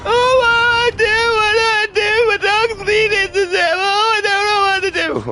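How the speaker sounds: sneering parody of a sobbing, panicking owner; mocking a weak man. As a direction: whimper like a helpless man whose dog is hurt. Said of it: "If I were one of you bitch-made men".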